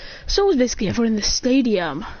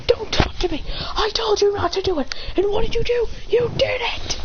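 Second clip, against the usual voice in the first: mock angry rebuke; 11 semitones higher than usual. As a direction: hiss at him, then build into scolding.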